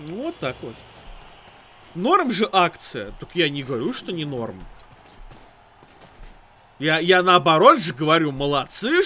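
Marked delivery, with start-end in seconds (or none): none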